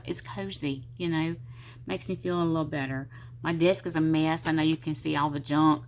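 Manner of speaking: normal talking voice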